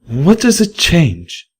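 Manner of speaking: firm but shaken mare; Velvet Remedy. A narrator's reading. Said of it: "shook a little, but stayed firm".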